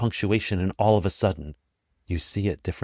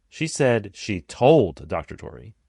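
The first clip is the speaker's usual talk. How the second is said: TOLD drawn out and punched; slow down and hit "told"; stress on TOLD; 3 dB louder, slower than usual. Shown as a demonstration. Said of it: "Changing the emphasis changes the entire meaning".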